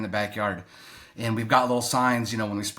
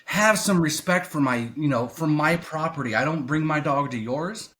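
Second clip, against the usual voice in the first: high-pitched, indignant rant held short of screaming; retold silent scream at a neighbour, controlled rage; mock inner yelling; 6 semitones higher than usual. Non-confrontational man imagining telling off a dog walker, mock yelling. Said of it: "I'm screaming like".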